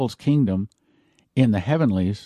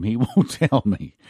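chuckling through the words; light, real laugh while talking; amused chuckle. Real, not acted. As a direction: let a real laugh break in mid-sentence. Amused that God keeps silent.

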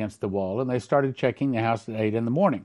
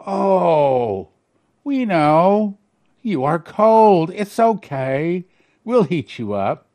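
mocking; 3 semitones higher, 4 dB louder, much slower than usual. Guards mocking the cold prisoner.

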